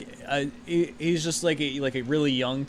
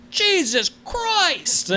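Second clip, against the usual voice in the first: high, drawn-out cry of dismay; shocked yell; 4 dB louder, much slower than usual. Acted. Pizzeria owner seeing his hurt delivery guy, hammed-up shocked yell. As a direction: stretch both words out in a high, hammed-up yell.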